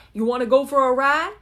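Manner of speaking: put-on [name] quote. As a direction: call it out like Ken in the song. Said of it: "they're from the song [name]".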